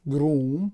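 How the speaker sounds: گروم, long oo, rising end; English word groom. Shown as a demonstration. Teacher presenting English groom with long oo. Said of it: "کلمهٔ دیگر رو داریم که".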